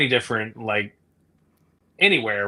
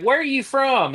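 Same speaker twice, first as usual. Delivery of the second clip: high-pitched mock questioning; nosy Southerner; 14 semitones higher than usual. Southerners quizzing a supposed outsider, high mock questioning. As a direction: imitate a local quizzing you, pitched way up. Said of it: "well, they'll be like".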